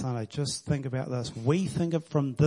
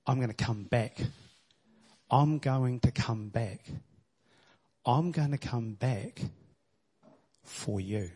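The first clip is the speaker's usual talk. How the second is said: soft promise; 2 semitones lower, 5 dB quieter than usual. Acted. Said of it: "Think about the guy".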